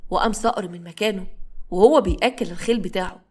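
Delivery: usual speaking voice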